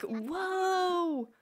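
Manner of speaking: high, drawn-out whoa of mock amazement; long high "whoa", then a hushed gasp of gosh; that exact amazed tone. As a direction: rehearse an awestruck reaction to a big find. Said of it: "tone of voice".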